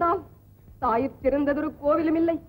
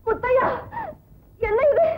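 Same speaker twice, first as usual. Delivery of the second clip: alarmed cry; 6 semitones higher than usual. Acted. Mother alarmed by her son's fury, startled cry.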